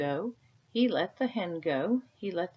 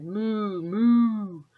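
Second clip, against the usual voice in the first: cow moo; 4 semitones higher, 8 dB louder than usual. Acted. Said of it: "The cow said".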